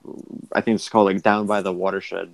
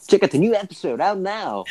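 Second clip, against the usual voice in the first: high-pitched, playful plug voice; mock promo plug; 10 semitones higher than usual. Host plugs the new episode, in a high mock promo voice. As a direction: sell it like a hammed-up promo.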